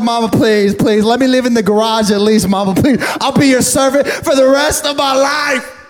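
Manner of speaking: hammed-up beg for mercy; begging. Boy begs his mama to spare him, hammed-up pleading. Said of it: "I started begging for my life".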